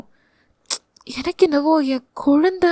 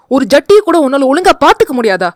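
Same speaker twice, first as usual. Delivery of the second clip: louder, fast scolding husband; 5 dB louder, faster than usual. Acted. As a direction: berate her for carelessness. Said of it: "என்று திட்டினான்". (மனைவியை திட்டும் கணவன்)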